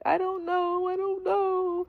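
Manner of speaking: put-on very high, small child-like whine; childlike whine. Drugged friend on the phone, high childish whine. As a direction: say it like a lost four-year-old. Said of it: "like talking to a four-year-old".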